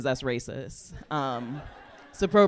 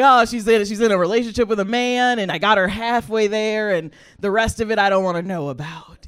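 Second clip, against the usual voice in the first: mom's relief; 3 semitones higher, 8 dB louder than usual. Retold or imitated. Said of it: "she was like".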